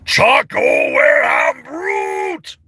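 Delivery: loud, very drawn-out roar; shouted character voice. Acted. Showing viewers another character, a long loud shout. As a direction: bellow each word long and hard.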